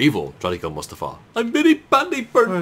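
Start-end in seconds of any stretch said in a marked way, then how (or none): 1.4-2.6 high, slow wailing character voice; mock movie character. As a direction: whine it like a cartoonish burned henchman.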